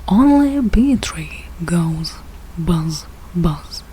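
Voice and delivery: usual speaking voice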